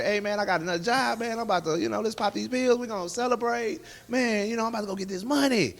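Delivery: high-pitched, hyped-up party talk; excited celebrating. Retold or imitated. Telling his buddy about another job, excited and high-pitched. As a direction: act out hyping up a friend to celebrate.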